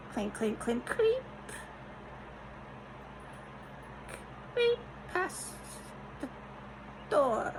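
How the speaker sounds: high, hushed, eerie sing-song; spooky mimicry. Telling viewers about neighbours creeping past, in a high hushed spooky voice. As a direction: creep the words out like a ghost story.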